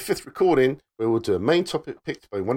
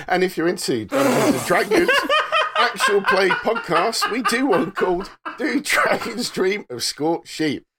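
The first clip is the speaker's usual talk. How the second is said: laughing; 6 semitones higher, 4 dB louder than usual. Real, not acted. Ad read still laughing.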